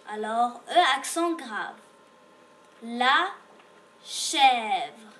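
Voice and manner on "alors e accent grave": ordinary delivery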